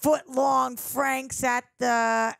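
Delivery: put-on slow, drawn-out Boston accent. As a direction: spell out the name like a proud Bostonian. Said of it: "Boston shout out".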